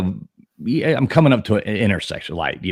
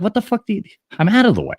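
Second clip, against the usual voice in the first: a much higher, incredulous mock retort; imitating indignant retort; 12 semitones higher, faster than usual. Answering the screaming driver, higher incredulous mock retort. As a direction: fire back, hammed-up disbelief. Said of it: "I'm like".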